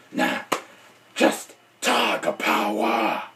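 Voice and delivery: low, slow hoarse growl; Batman. Acted. Batman corrects the catchphrase, slow raspy growl. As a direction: rasp it out deep, stretching the last word. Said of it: "and me, Batman".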